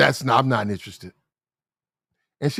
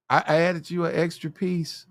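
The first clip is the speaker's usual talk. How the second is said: flirty cashier; 3 semitones higher, 4 dB quieter, slower than usual. Retold or imitated. Cashier flirting, voiced by comic.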